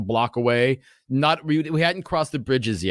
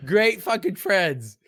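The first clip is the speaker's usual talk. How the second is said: higher-pitched mock indignation; sarcastic reproach; 6 semitones higher than usual. Man scolding friends who ran from cops, sarcastic. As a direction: drag out the sarcastic praise, pitched up.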